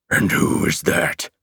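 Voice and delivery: slow, low, whispery monster growl. A narrator's reading. Monster challenging the hero, low slow growl. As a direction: growl the question slowly and low.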